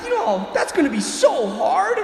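high, loud mock whine; hammed-up self-pity; whining complaint.

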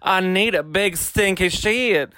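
drawn-out mocking impression; slow put-on voice. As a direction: drag each word out slowly. Voicing Pandora in confessional.

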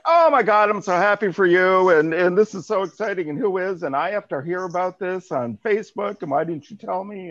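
loud, high, gushing congratulations; mock gushing friend. Friend gushing over Facebook news, mock excited. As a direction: play the over-thrilled friend who just saw the news. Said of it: "I was like".

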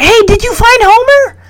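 high-pitched, excited question; imitating a kid who just jumped up; eager kid. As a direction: pipe up high like an eager child. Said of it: "Arnold jumps up and is like".

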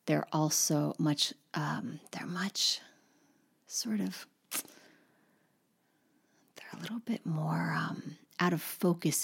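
whispered, soft and drifting. Real, not acted. Teacher whispering how French vowels go out of focus. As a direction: let the words fade into a whisper.

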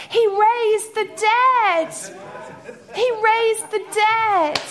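loud high slow declaration; shouted proclamation. Real, not acted. Preacher proclaims to congregation, loud and slow. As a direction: cry it out triumphantly.

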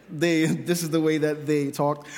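usual speaking voice

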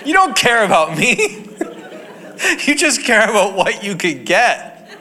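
hammed-up laughing indignation; mock outrage. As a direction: play betrayed, laughing. Mock outrage at betraying niece.

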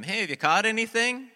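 high, loud call out across the water; yelled call. Retold or imitated. Jesus yells from shore to the fishermen, yelled call. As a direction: holler it far off to someone in a boat. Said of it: "he yells out to them".

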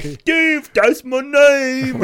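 slow silly derp reply. Retold or imitated. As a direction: ham it up like a goofy simpleton. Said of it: "the best fucking derp voice".

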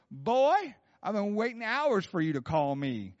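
loud, high Southern scolding; Bob Jones. Retold or imitated. Prophet chiding a late caller, high Southern accent. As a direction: open on a hollered Southern "Boy". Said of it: "giving you a little Bob Jones accent".

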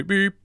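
loud, very high vocal beep; piercing beep by mouth; imitated phone beep. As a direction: mimic the transfer tone, sharp and high.

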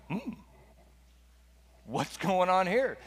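acted intrigued question; puzzled curiosity. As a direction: play someone slowly puzzling over a strange sight. Acting Moses at burning bush.

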